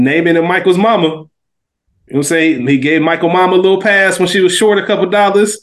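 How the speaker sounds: ordinary talk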